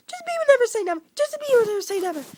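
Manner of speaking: title squealed very high and loud; hammed-up fan scream of the title; mock fangirl shriek. Shown as a demonstration. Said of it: "that was terrible".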